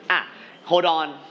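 usual speaking voice

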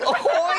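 a high shouted ouch; pained yelp. Retold or imitated. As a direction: yelp high like the kick just landed. Imagined kick landing. (ร้องโอ้ยเสียงสูงดัง)

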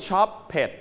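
"shop pet" in two syllables; wrong pronunciation. Shown as a demonstration. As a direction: wrongly sound out the -ed as a separate "pet". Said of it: "we don't pronounce it". (อ่าน "shop pet" ผิดเป็นสองพยางค์)

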